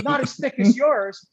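high-pitched mock angry retort; retold comeback. Replaying own comeback to the coach, mock defiant. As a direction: toss the comeback up high, arguing in play.

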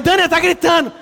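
high and loud, alarmed; alarmed exclaim. Retold or imitated. Father alarmed to his wife as the girl screams, high alarmed exclaim. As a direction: blurt it in panic. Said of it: "Aí eu falei".

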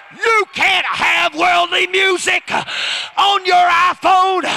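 high, slow shouted declaration; full-force preaching. Real, not acted. Preacher warns congregation against worldly music, shouted at full force. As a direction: shout each word out to the whole congregation.

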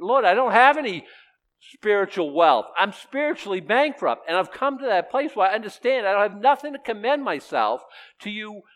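high, loud pleading cry to God; loud desperate confession; impassioned confession. Pretend. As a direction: plead it out, heart broken open. Preacher voicing a confessing sinner.

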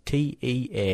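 slow letter-by-letter spelling; spelled out. Shown as a demonstration. Mocking sister's spelling of teacher, slowly spelled out. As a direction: spell each letter out separately. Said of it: "She put, um, teacher is".

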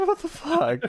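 high, startled disbelief; shocked. Retold or imitated. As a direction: blurt it out in shock. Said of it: "it's like".

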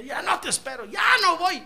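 a high, loud, sulky brother shouting back; angry kid brother. Retold or imitated. Bored younger brother snapping at his sibling, angry shout. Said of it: "se enojaba y me decía".

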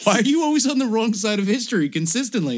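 high, loud scolding; mocking friends. Others scolding the Canadian, high loud mock. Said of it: "They're like".

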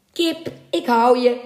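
taunting cry over the catch; mocking call. A narrator's reading. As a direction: gloat as a second sailor. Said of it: "riep een ander".

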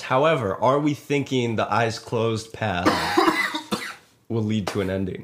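normal talking voice

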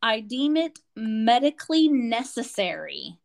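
very slow, word-by-word dictation; dictating a form. Retold or imitated. Doctor writing equipment needs on form, slow deliberate dictation. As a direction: spell it out officially, pausing between phrases. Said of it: "equipment do you feel this person needs".

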